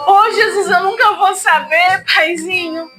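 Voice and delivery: ordinary talk, nothing marked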